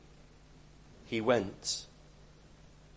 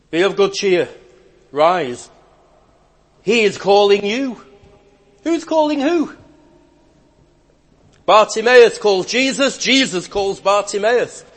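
loud, high ringing proclamation; fervent pulpit cry; preaching at full force; 7 semitones higher, 10 dB louder than usual. Acted. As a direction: call it out to the whole church.

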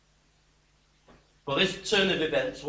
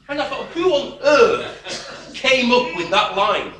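laughing; 7 semitones higher, 6 dB louder than usual. Real, not acted. Laughing at a silly school advert.

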